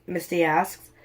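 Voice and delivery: plain reading voice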